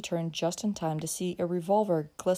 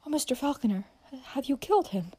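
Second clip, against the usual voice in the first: put-on high-pitched, frightened girl; aghast, pitched-up question; alarmed; 6 semitones higher than usual. A narrator's reading. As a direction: ask in anxious horror. Girl fears he killed the man.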